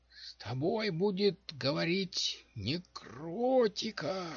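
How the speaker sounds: high, slow crone voice, half-whispered; lightly whispered old witch warning; whispering old witch. A narrator's reading. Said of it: "прошептала бабуля". (шёпот старой ведьмы)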